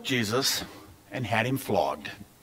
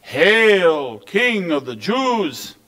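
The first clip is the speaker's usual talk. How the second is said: high, drawn-out mock salute; stretched, taunting proclamation; 6 semitones higher, much slower than usual. A narrator's reading. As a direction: hail him with slow, exaggerated false reverence. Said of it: "mocked him by saying".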